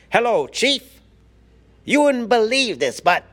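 very high, loud comic secret-agent voice; Maxwell Smart impersonation. Acted. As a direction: report to the boss in a high-pitched, put-on TV spy voice. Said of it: "that's my impersonation of, uh, Maxwell Smart".

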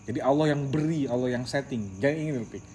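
ordinary talk

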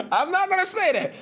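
high giggling through the words; laughing at his own confession. Real, not acted. To his viewers, laughing while admitting it. Laughing retelling about mom.

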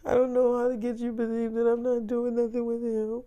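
high-pitched mock-tearful whine; acted tearful protest; imitated crying. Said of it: "So she calls back crying".

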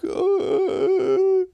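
very high, teary mock sob; mock crying wail. New samurai weeping at Odin's request, high hammed-up wail. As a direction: wail it like grateful tears. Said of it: "they're all coming together crying".

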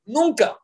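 "Nunca" hit high and firm; emphatic high "Nunca". Real, not acted. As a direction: drive home the "Nunca".

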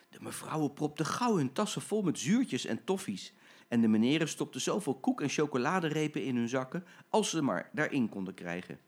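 usual speaking voice

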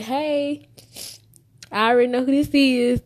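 high, loud, fast mock greeting; a coworker teasing the caller; coworker's greeting. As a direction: play the coworker who knows the caller. Coworkers answering her calls.

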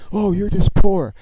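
loud, high-pitched sneering imitation; mocking. Rival fan insulting him over his jersey, mocking. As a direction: taunt like an obnoxious rival fan. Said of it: "try to mock me".

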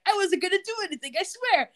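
high, rushed, pleading whine; hammed-up scared pervert pleading; panicky peeper. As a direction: beg fast in a high voice, caught red-handed. Peeper Ted caught at knifepoint.

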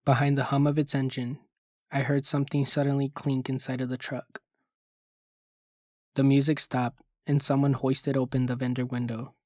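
ordinary delivery, nothing marked

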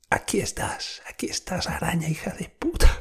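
whispered, mock-menacing taunt. Holding the spider in his fingers, whispering a taunt. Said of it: "ahí como".

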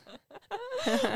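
real giggle at a shared joke; high burst of laughter. Real, not acted. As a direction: squeal of a laugh.

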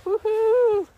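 loud, high woohoo; excited woohoo. Real, not acted. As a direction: cheer out loud in the snow. Making the snow angel.

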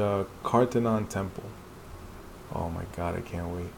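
ordinary talk, nothing marked

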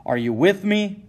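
high, loud challenge; Isaac Sears calling on the merchant to join; rousing cry. Acted. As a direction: call out to the recruit. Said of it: "cries Sears".

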